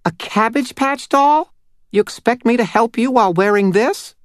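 very high, tiny doll voice. Acted. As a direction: squeak it out like a little doll. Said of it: "asked in a tiny voice".

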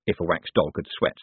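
ordinary delivery

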